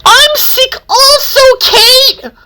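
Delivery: very high, miserable whimper; acted poorly-and-miserable voice. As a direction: put on a high, sickly misery. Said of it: "Conroy said miserably".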